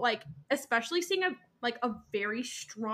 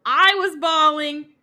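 loud emphatic exclamation; 5 semitones higher, 10 dB louder than usual. Real, not acted. Telling friends we all cried at the reading, loud and emphatic. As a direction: belt it out, stretching the words.

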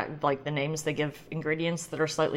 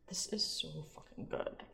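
real whisper, very soft; awed whisper; 15 dB quieter than usual. Real, not acted. Taster overcome by a great rosé, awed whisper. Overwhelmed by the wine.

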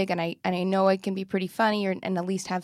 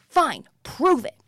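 slow, higher, louder taunting dare; defiant challenge; 7 semitones higher, 5 dB louder, slower than usual. Retold or imitated. Tim daring her in the sketch, slow taunting challenge. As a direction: throw it down as a challenge. Partner's line in fighting sketch.

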